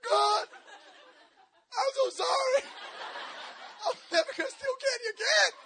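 hammed-up repentant cry, high and loud; imitating a tearful confession; high-pitched, loud mock pleading. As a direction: beg God in a high, comic wail. Breaking down at altar.

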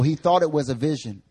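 ordinary delivery

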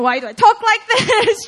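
loud, high, breaking into a laugh; mock whine that dissolves into laughter; laughing at herself; laughing self-complaint.

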